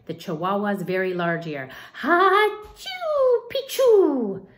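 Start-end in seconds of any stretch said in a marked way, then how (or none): 1.9-4.5 high sneezed password; high mock sneeze; half sneeze. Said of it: "half sneezed".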